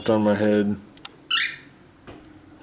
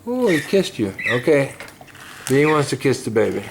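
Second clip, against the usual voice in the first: usual speaking voice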